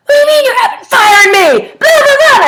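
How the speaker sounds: high, loud screaming fit at the boss; furious outburst. Shown as a demonstration. Fired employee screaming at the boss in a furious outburst. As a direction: throw a tantrum, shrieking and loud. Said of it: "But if I did this instead".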